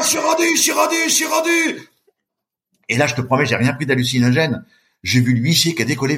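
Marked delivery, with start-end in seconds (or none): 0.0-1.9 very high-pitched shout. Retold or imitated. Swimmer shouting that he has made it, very high-pitched. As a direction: yell it out in triumph and relief. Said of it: "Je pousse un grand cri".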